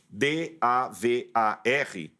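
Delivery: "D A V A R" spelled slowly, one letter at a time. Shown as a demonstration. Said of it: "Quando eu escrevo".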